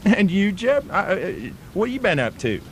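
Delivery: broad put-on Australian bloke spinning a yarn; Australian accent. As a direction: play an outback Australian storyteller.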